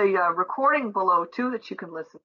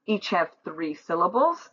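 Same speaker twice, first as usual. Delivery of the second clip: usual speaking voice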